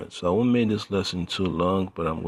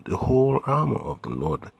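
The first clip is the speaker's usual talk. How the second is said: "whole" lifted high and emphatic; stress on whole; 8 semitones higher, 4 dB louder than usual. Shown as a demonstration. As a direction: preach it, punching the word "whole" upward. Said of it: "More emphasis on that word".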